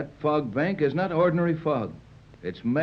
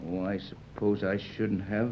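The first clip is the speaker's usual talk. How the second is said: soft, slow, lower admission; sheepish; 6 semitones lower, 6 dB quieter, slower than usual. Acted. Tex admitting his feelings to Patricia, sheepish and soft. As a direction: confess it quietly. Shy romantic moment.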